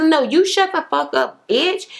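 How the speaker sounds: high-pitched retort, stress on "you"; mock angry comeback. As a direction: throw it back in a high mock-quarrel voice. Retelling a quarrel's comeback.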